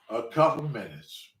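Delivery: low, quiet repeat of the reply; unimpressed echo. Retold or imitated. Waiting driver repeating cousin's text, unimpressed. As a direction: drop down and echo it under your voice.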